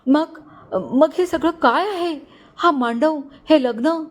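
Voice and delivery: jilted bride asking about the wedding, voice trembling. A narrator's reading. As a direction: ask it in a shaken, bewildered tremble. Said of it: "कातर आवाजात विचारले".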